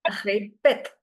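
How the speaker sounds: répète modelled with its changing E; flat E, then sharper E in répète; two E sounds in répète. Shown as a demonstration. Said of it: "this is how the sound of E changes".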